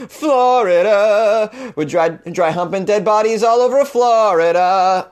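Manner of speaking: slow announcer voice, milking each word; dramatic movie-trailer read; booming mock announcer.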